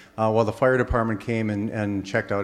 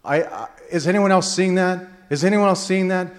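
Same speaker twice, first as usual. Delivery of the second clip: higher, rattled stammering question; a colleague in the car asks, stunned and stammering; stunned disbelief; 6 semitones higher than usual. Retold or imitated. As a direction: stammer it out, badly shaken. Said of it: "she goes".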